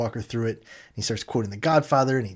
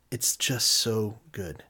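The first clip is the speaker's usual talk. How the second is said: slow savoring; 2 semitones lower, 5 dB quieter, much slower than usual. Real, not acted. Savoring the sandwich, slowed.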